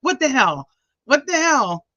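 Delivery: high, loud disbelieving cry; squeaky outraged double take; shocked. Retold or imitated. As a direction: act out your horror at the toe. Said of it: "I'm gonna be like".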